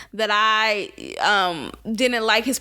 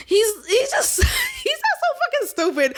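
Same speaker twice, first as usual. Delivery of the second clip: much higher laughing half-shout; laughing outburst; 12 semitones higher than usual. Real, not acted. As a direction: burst out laughing as you yell it.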